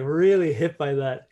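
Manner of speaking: usual speaking voice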